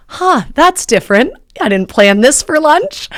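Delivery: high-pitched, bemused surprise; bemused realisation. Retold or imitated. Diner realises she is at a plastic surgeon's office, bemused.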